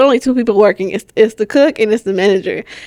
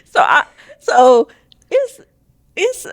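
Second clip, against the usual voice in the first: laughing; 5 semitones higher, slower than usual. Real, not acted. Starting story while still laughing.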